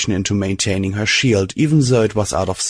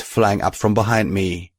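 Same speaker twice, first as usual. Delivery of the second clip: plain reading voice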